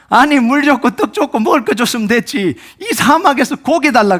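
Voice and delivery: acted fed-up protest; exasperated outburst. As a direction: blow up at an unreasonable demand. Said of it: "모세를 한 방 팡 터뜨려버린".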